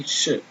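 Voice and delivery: "che" as one soft sh sound; French ch. Shown as a demonstration. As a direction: say "che" as a single sh. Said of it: "we don't say che. It's only che".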